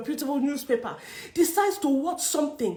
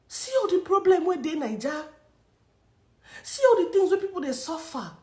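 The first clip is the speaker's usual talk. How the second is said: softer, higher, near tears; tearful anger; 4 semitones higher, 4 dB quieter than usual. Real, not acted. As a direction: choke it out hurt and angry. Tearful complaint.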